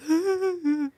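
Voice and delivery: soft high wordless whimper; imitating timid singer. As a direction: mimic feeble, barely audible singing. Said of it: "he's literally going".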